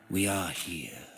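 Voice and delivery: ordinary delivery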